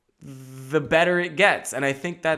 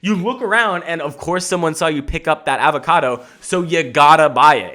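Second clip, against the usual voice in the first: usual speaking voice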